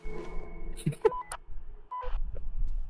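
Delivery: high breathless silent wheeze; wheezing laugh. Real, not acted. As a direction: laugh so hard no voice comes out. Laughing at teammate's request.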